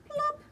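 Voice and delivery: high plop sound effect. A narrator's reading. Bird falls to ground.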